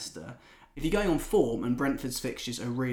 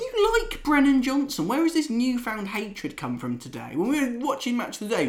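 higher, louder playful ribbing; gentle teasing; 11 semitones higher, 4 dB louder than usual. Real, not acted. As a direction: needle your friend with a grin. Teasing co-host about Brennan Johnson.

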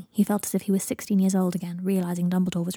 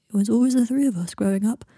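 lightly acted grief, voice wavering; mildly upset, on the edge of tears; wobbling voice; 3 semitones higher, 4 dB louder than usual. A narrator's reading. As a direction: let the grief push the voice a touch. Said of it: "his voice wobbling".

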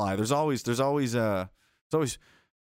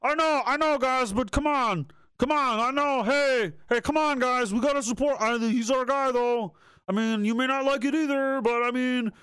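mock pep talk; 8 semitones higher than usual.

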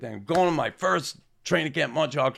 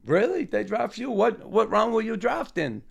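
high, amused disbelief; mock incredulous star player; surprised disbelief; 10 semitones higher than usual. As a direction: act like you can't believe the kid was drafted. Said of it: "Lafleur says".

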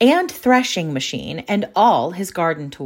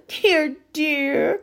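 lightly voiced twins' lament; 10 semitones higher than usual. A narrator's reading. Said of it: "cried the twins".

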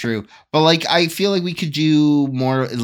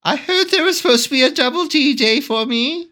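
high put-on princess voice; hammed-up high figure; melon princess; 13 semitones higher than usual. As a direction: ask in a much raised, pretend princess voice. Joins the princess bit.

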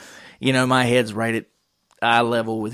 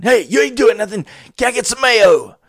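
loud, high waitress bark; barked demand, pitched way up; 9 semitones higher, 5 dB louder than usual. Retold or imitated. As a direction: imitate her yelling at the kitchen line. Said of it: "barking at the guys behind the line".

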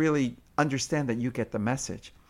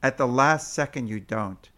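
normal talking voice

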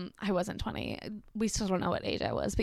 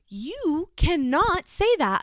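slow and firm, higher, each word laid down; stern scolding; 7 semitones higher, 3 dB louder, much slower than usual. Retold or imitated. Babysitter scolds the boy, slow stern warning. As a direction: lay down the rule, very slowly.

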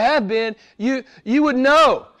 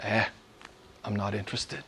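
dismissive shrug; 13 semitones lower, 16 dB quieter than usual. Retold or imitated. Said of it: "most of the world says".

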